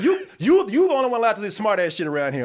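high, loud mock snap at the host; hammed-up indignant rant; imitating an irritated guy. Sour stranger scolds the host about smart answers, mock irritated. Retold guy snaps at him.

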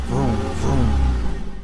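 low, loud revving car noise; engine vroom. A narrator's reading. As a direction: rumble it like a race car engine. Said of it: "said Mister Watson in his sleep".

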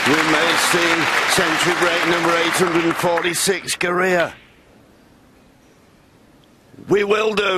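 high, loud thrilled commentary; excited. Real, not acted. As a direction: build to the century. Century break approaching, commentator thrilled.

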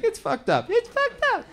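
put-on very high, giggly little kid; toddler voice. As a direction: squeak it out like a gleeful toddler. Toddler parroting again, laughing.

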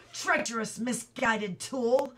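loud angry outburst at a tool; shouted. A narrator's reading. Boy shouting at the chisel that slipped, angry. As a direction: yell it out in fury, drawn out. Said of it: "he shouted".

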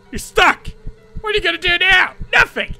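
high laughing jeer; gloating taunt. Real, not acted. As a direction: crow over the stuck enemy. Taunting monster again, laughing.

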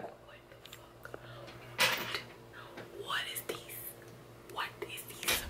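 whispering in disbelief; hushed, stunned whisper to herself; awed whisper. Real, not acted. Stunned at own hair.

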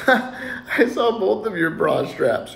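high, drawn-out words through a laugh; laughing kid. Acted. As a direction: giggle it out like a cheeky kid. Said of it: "Another kid laughs".

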